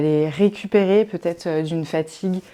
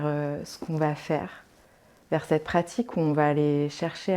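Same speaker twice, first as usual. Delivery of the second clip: soft, calm relaxation voice with long pauses; guided meditation; 5 dB quieter than usual. Acted. Yoga teacher settling listeners into Yoga Nidra, calm guided meditation. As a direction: ease them gently into rest, leaving space to breathe.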